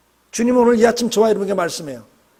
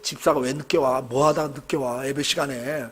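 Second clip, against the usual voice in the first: irritated inner grumble; 7 semitones lower, 6 dB quieter than usual. Retold or imitated. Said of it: "머릿속으로는".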